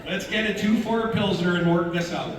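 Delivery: jokey Canadian lawman, laughing along; Canadian Ranger. Pretend. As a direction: play a genial ranger in a broad Canadian accent. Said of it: "Canadian Ranger".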